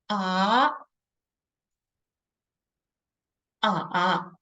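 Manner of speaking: rising ah, then dipping ah; second versus third tone. Shown as a demonstration. Teacher contrasting the second and third tones. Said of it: "the second and the third will sound like".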